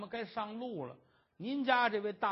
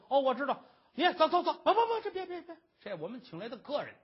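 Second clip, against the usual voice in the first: 高声急嚷，忽然改口; 先轰要饭的，再慌忙拦住; 急忙轰人; 8 semitones higher, 7 dB louder, faster than usual. Acted. 伙计轰人又改口. (伙计高声急赶，连忙改口)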